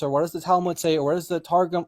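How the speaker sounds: usual speaking voice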